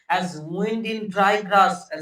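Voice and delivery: loud, slow, hushed-voice poetry reading; dramatic recital. Shown as a demonstration. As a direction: stretch each word like wind through grass. Recites poem line dramatically.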